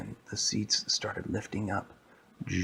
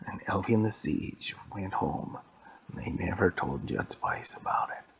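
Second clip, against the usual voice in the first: plain reading voice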